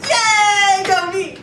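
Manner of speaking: loud, high gleeful cheer; hammed-up self-congratulating cheer; mock cheer. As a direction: cheer for yourself like you won. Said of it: "So I'm thinking".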